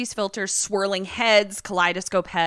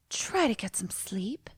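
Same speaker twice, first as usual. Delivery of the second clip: soft, breathy whisper; affected; 8 dB quieter than usual. Retold or imitated. Woman settling crying lover before leaving, affected whisper. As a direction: imitate her affected bedside hush. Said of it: "everything she says is in a very affected".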